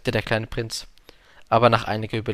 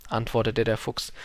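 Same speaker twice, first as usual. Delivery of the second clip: ordinary delivery, nothing marked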